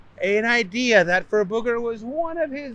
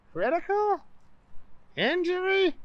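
high puzzled voice; 13 semitones higher, 4 dB quieter than usual. Acted. Booger repeats, puzzled.

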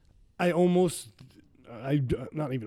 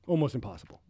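ordinary talk

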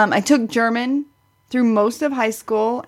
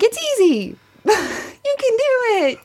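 high, bright, laughing "you can do it", slowed at the end; mimicking cheerful encouragement; 12 semitones higher than usual. Teasing her podcast co-host, echoing her breezy cheer. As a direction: play her easy confidence back with a laugh. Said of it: "And you were like".

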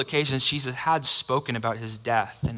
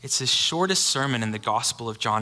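ordinary talk

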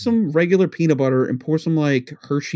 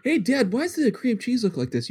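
mock kid; 8 semitones higher, 4 dB quieter than usual. Kid voice asking dad.

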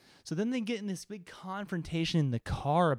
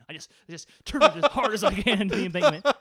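laughing; 5 semitones higher, 8 dB louder, faster than usual. Retold or imitated. Player laughing through final action.

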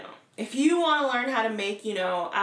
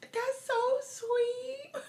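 high, very slow tearful wail; hammed-up sobbing through the words; mock crying; 14 semitones higher, much slower than usual. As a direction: Tabitha moved to tears by the video. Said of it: "she's like".

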